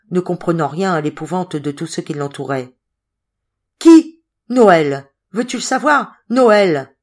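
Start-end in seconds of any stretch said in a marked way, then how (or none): 3.8-6.9 loud, exasperated outburst; clamouring shout. A narrator's reading. Coriolis tells Patrice it is Noël, clamouring. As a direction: proclaim the name at full voice. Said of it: "clamait Coriolis".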